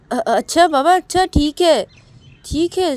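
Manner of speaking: high, loud, flustered giving-in; hurried placating. A narrator's reading. Siya giving in to furious Shruti, flustered placating. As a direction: back down quickly to stop the outburst.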